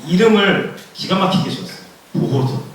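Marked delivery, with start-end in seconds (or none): none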